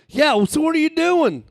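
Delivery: high, loud tourist voice; eager tourist. Retold or imitated. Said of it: "he's like".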